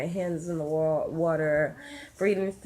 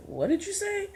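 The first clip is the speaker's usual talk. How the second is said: very high-pitched imitation of alarm; startled question; 14 semitones higher than usual. Boyfriend reacting to the urge to push, startled. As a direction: voice shoots up in disbelief.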